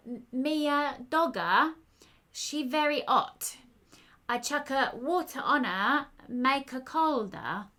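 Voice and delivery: slow, loud, hammed-up fake Italian accent with missing words; bad Italian accent. A narrator's reading. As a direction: ham up a terrible Italian accent, dropping words. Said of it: "adopted a very bad Italian accent and began to miss out words".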